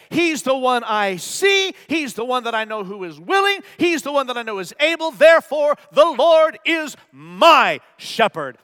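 loud, emphatic declaration of faith; ringing pulpit proclamation; full-force preaching. Acted. Preacher builds to quoted declaration.

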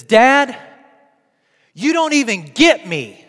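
put-on loud, high-pitched upset child; retold mad cry. Upset young daughter snapping at her dad, mad cry. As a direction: imitate a daughter's angry outburst at dad. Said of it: "it was like a mad cry".

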